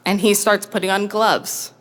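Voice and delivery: normal talking voice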